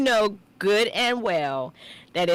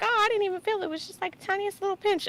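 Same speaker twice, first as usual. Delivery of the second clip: high, airy mimicry of a child; imitated young son; 13 semitones higher, much faster than usual. Mother mimicking her son after his shot, high child voice. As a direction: mimic a kid brushing it off in a high voice.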